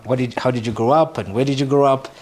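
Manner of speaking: ordinary talk, nothing marked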